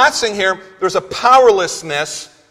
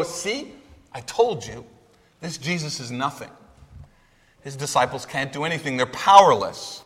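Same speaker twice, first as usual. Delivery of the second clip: gloating scribes; 6 semitones lower, 5 dB quieter than usual. Retold or imitated. Preacher voices the scribes mocking the disciples, a hushed sneer. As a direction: lower and softer, a sly sneer. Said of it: "like vultures".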